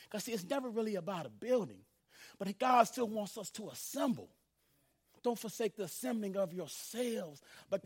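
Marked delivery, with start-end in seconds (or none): none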